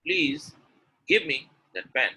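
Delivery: PLEASE set apart, then GIVE punched; halting request, PLEASE on its own; pause after PLEASE. Shown as a demonstration. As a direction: break after PLEASE, end on a fall. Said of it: "The communication is different?".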